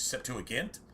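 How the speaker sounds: Septuagint with a hard G; hard-G Septuagint, tentatively. Shown as a demonstration. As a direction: say Septuagint ending in "gint", as in gamma. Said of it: "pronounced with a hard G".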